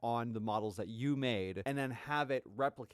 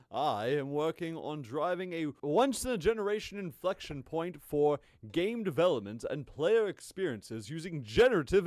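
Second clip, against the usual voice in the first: pompous voice; 3 semitones higher, 4 dB louder than usual. Retold or imitated. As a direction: read it like a self-important executive. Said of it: "I can go out of his voice now".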